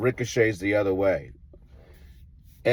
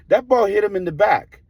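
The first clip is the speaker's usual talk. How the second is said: higher, louder, hammering the point; insistent; 11 semitones higher, 5 dB louder than usual. Retold or imitated. Coach insisting to the umpire, loud and high.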